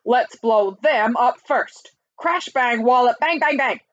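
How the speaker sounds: gung-ho general crying out; excitable army chief. A narrator's reading. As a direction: blurt it out like a trigger-happy general. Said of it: "cried the chief of the army".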